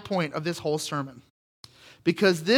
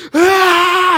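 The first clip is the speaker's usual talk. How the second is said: loud, high burst of rage; angry yell; 12 semitones higher, 12 dB louder than usual. Retold or imitated. Kicked dad roaring at his son in anger. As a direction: let out a furious roar. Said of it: "I was like".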